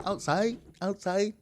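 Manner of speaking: high rising sing-song outside; recorded dog-command voice. Retold or imitated. Owner to puppy, high sing-song command. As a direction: coax it like to a puppy. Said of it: "I recorded my voice saying".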